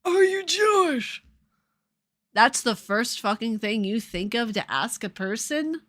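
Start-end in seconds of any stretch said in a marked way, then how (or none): 0.0-1.2 high, drawn-out mock question; mocking imitation. Streamer mimicking a nosy chat commenter, mockingly.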